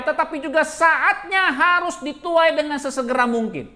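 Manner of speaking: loud, high preaching; khotbah berapi-api. Acted. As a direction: preach it with full fire to the crowd. Pastor opens sermon at full force. (khotbah berapi-api dengan suara lantang)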